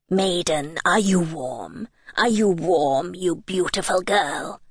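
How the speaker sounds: slow, low King Frost voice. A narrator's reading. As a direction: draw out the question, low and looming. King Frost asks again.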